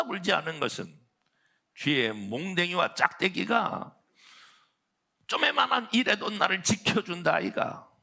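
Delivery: hammed-up Jeolla country voice, giggling through it; Jeolla dialect. Preacher reading Psalm 23 to the congregation in Jeolla dialect, laughing. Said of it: "전라도 버전".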